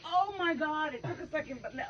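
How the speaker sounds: ordinary talk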